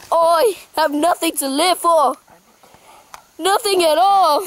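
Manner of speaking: hammed-up tearful Aussie wail; mock despair. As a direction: lament as if all is lost. Mock despair over the lost house.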